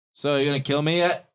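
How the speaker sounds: lightly voiced prisoner goading a captor; taunting prisoner. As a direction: call it out as a challenge. Caged prisoner taunts his captor.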